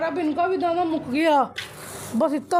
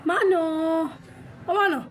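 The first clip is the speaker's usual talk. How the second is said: calling out; 2 semitones higher than usual. Real, not acted. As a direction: call out to Mano. Calling the cat Mano.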